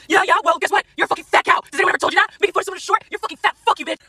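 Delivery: high-pitched mock rant; squeaky-high mocking imitation; mocking angry commenters. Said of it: "posting all kinds of shit like".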